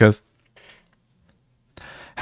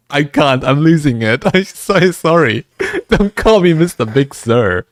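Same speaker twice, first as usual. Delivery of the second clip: giggling through the words, pitched higher; can barely get it out for laughing; higher, louder laughing protest; laughing while talking; 6 semitones higher, 3 dB louder than usual. Retold or imitated. Retold reaction to funny email.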